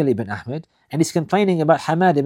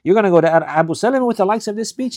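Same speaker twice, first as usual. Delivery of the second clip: incredulous question; 6 semitones higher than usual. Retold or imitated. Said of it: "He said".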